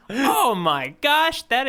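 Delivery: drawn out, high, strained oh my gosh; cringing exclamation. Real, not acted. Recalling the dragged toe to his cohost, cringing high and slow. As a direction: wince through it slowly.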